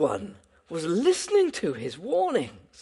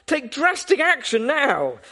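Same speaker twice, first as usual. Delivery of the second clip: retold shout; 6 semitones higher than usual. Policeman on the roadside shouting at drivers, urgent. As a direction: shout the warning at passing drivers. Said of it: "he was shouting".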